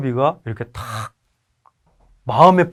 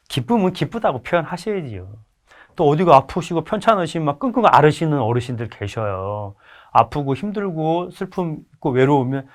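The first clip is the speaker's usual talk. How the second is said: ordinary delivery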